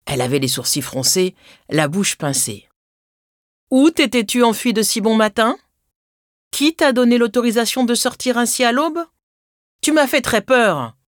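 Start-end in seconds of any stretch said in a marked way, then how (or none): 3.7-10.9 scolding mother, pitched higher; lightly acted motherly telling-off; mild angry cry. A narrator's reading. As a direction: scold a runaway child after a scare. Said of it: "se mit-elle à crier".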